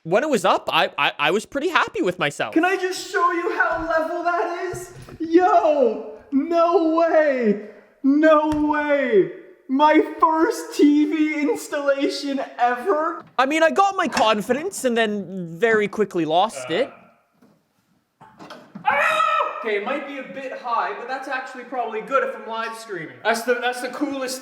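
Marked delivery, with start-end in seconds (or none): 2.6-13.2 high, amazed and giddy; thrilled, can't believe it; excited disbelief. Real, not acted. Amazed at level TV. 18.8-19.6 sharp high yelp of alarm; a sudden high cry; startled shriek. Real, not acted. Sudden shriek, confidence lost.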